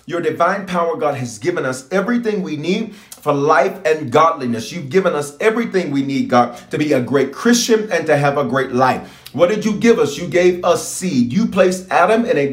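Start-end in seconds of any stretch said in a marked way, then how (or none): none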